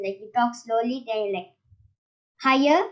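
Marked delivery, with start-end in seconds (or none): 2.4-2.8 a louder burst, rising at the end; raised voice. Shown as a demonstration. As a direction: lift and punch the word for modulation. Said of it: "You must talk a bit loud".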